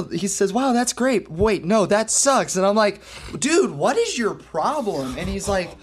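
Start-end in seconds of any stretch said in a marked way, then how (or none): 0.5-2.6 high, gushing praise flipped to a verdict; kid's supposed tic. Retold or imitated. Kid to drummer setting up, imitated sudden switch. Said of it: "That's one of my tics". 3.4-5.2 high, drawn-out retold exasperation; exasperated. Drummer confronting rude kid, exasperated.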